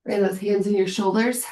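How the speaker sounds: ordinary talk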